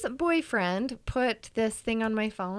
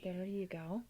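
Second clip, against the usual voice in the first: whispered; 4 semitones lower, 13 dB quieter than usual. Real, not acted. Wi-Fi name kept off podcast.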